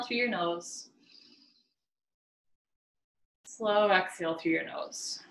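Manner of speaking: measured breathing cues with long pauses; spacious meditation-guide delivery; guided meditation. Acted. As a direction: guide each breath in and out, unhurried. Leads slow breathing.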